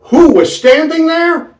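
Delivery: high-pitched suspense; suspense question. A narrator's reading. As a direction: build the twist.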